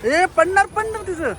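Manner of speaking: high startled cry of warning; sudden alarm. Real, not acted. Parent warns that the child's ice ball is falling, startled high cry. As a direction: yelp it out as something starts to fall. Child's food about to drop.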